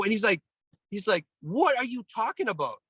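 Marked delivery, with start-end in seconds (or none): 1.4-2.8 high, mock disbelief in the coach's voice; incredulous coach. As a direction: throw it back in disbelief. TK incredulous, retold.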